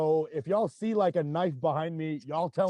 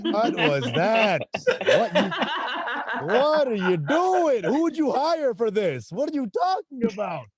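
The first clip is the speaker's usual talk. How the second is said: mock outraged bewilderment; 3 semitones higher, 3 dB louder than usual. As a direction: demand an explanation in hammed-up disbelief. Mock outrage at the fake accent.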